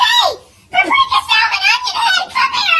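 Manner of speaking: high frantic shout; mock frantic parent yell; yelling across playground. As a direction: shout for the runaway kid. Yelling for kid.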